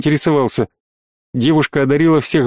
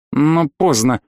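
usual speaking voice

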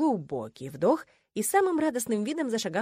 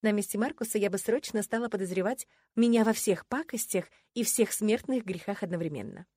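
usual speaking voice

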